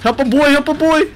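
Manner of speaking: high frantic plea for help; panicking gamer. Real, not acted. Begging teammates for help.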